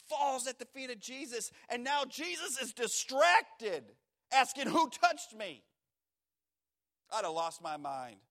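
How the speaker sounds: fired-up preacher peaking; preaching at full force. Acted. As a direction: build the tension until it breaks, loud and high. Preacher climbs to shouted peak.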